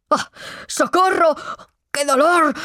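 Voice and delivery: high-pitched yell for help; pained, pleading shout; cry of pain. A narrator's reading. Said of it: "gritó el padre Lorenzo".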